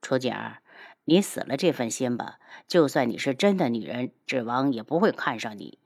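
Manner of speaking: crush their hopes icily; low-pitched contemptuous dismissal; cold, scornful. Acted. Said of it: "冷哼道".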